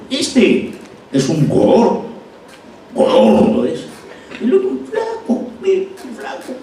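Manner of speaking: playful exaggerated description; expressive mocking contrast. Shown as a demonstration. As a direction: stretch and color the words gordo and flaco. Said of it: "si yo transformo eso".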